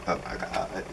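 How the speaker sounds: breathy whispered plea to God; despairing whisper. Retold or imitated. Vlogger whispering a plea to God after the guest's speech. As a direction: whisper a weary prayer to be taken. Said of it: "I'm like".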